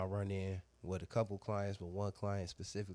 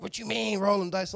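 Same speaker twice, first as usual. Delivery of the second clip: much higher, louder imitated woman's question; mock woman voice; 11 semitones higher, 10 dB louder than usual. Man mimicking the woman questioning his condom joke: high mock voice. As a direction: squeak her question back like she's shocked.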